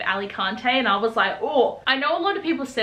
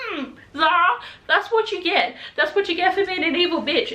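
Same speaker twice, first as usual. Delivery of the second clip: gloating taunt; 6 semitones higher than usual. Retold or imitated. Emma gloating at Zara.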